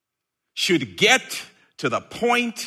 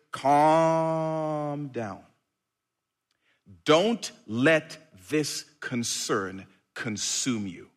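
calm reassuring; 3 semitones lower, 5 dB quieter, much slower than usual. Acted. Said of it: "Jesus' first words".

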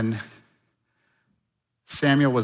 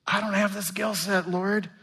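mock excuse; 7 semitones higher than usual. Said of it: "use that one as an excuse".

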